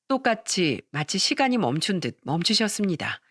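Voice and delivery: ordinary delivery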